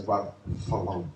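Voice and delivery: Barcelona with a th for the c; Spanish lisp. Shown as a demonstration. Preacher explaining his joke, Barcelona with Spanish lisp. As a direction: say Barcelona lisped, Castilian style. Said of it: "speak with a lisp".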